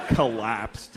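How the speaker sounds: usual speaking voice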